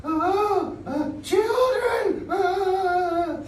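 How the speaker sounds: very high, timid squeaky puppet voice; a shy little puppet peeking out. Acted. Shy puppet nervously greeting the class, high timid voice. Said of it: "introverted and shy".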